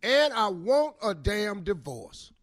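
ordinary talk

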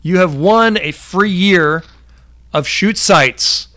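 loud, slow prize announcement; excited announcement. Real, not acted. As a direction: proclaim the prize with joy.

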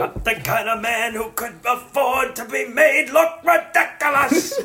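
high, slow put-on mogul; Jack Woltz voice. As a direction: sneer like a proud studio boss. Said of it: "throw Jack Woltz impressions".

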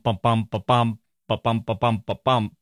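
"ba-bum" chanted with the stress on "bum", over and over; iambic beat. Shown as a demonstration. Host sounding out iambic meter for the poet, "ba-bum" stressed on "bum". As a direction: tap out "ba-bum, ba-bum" as a da-DUM rhythm. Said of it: "something like ba-bum".

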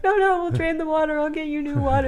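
crying mom laughing through tears; tearful mom. Retold or imitated. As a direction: blurt it out while half crying. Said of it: "I'm like".

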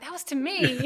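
high, thrilled boast; giddy schoolgirl. Retold or imitated. Fan telling husband about the drummer, giddy. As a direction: gush it like a starstruck fan. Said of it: "You're like a giddy schoolgirl".

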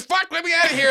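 high mock scream; high acted yell without full volume; Shifting Sammy screaming. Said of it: "Shifting Sam starts screaming".

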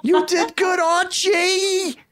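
very high, drawn-out sitcom wife impression; Edith. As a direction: call your husband to dinner in a high screechy voice. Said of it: "let's hear your Edith, uh, imitation".

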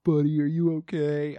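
lightly put-on cooing concern; a hint of babying a hurt child; mock concerned dad. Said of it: "I didn't ask him, like".

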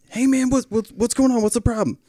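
high-pitched imitation of an agitated man; confrontational neighbor. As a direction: voice a neighbor demanding answers.

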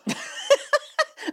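wheezing giggle; high breathless laugh. Real, not acted. Cracking up at his own story, high wheezing laugh. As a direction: burst into a silent wheeze.

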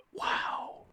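breathless whispered wow; awed whisper. Real, not acted. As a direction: whisper it in awe. Awed reaction after verse.

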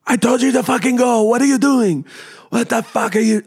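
very high, furious mock shout; imitated yelling. Husband yelling at his wife after crash, imitated. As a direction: berate in a strained high imitated yell. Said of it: "he just starts yelling at her".

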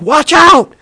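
high, loud cry of alarm; imitated shriek of warning; warning shout. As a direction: yell a sudden warning as a panicked passenger. Girlfriend's warning cry in retold story.

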